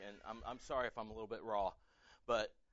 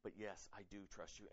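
hushed surrender; 5 semitones lower, 7 dB quieter than usual. Retold or imitated. Voicing a hurting believer's surrender.

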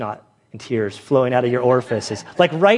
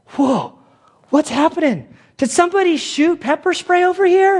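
much higher, panicky; alarmed; 13 semitones higher than usual. Retold or imitated. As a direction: cry out as your eyes start burning. Coworkers hit by pepper spray.

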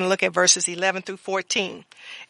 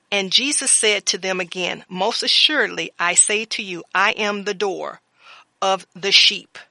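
louder, measured sermon reading of scripture; 6 dB louder than usual. Acted. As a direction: proclaim the verses to the congregation.

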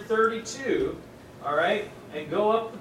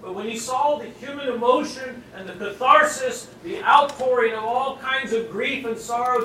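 usual speaking voice

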